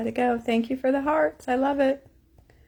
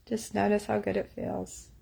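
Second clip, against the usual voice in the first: softer, hushed guiding repeat; 9 dB quieter than usual. Shown as a demonstration. Tapping coach softly guiding viewers into a good memory. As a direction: let it fade to a gentle hush.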